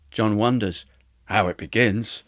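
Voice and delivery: usual speaking voice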